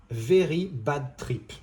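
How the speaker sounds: Very Bad Trip said slowly with a deliberately thick French accent; heavy French accent. Shown as a demonstration. Film critic mocking a title, exaggerated French accent. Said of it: "je le dis avec un accent français, appuyé volontairement".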